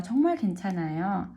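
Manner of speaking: rushed emphatic. Shown as a demonstration. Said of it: "It's really okay".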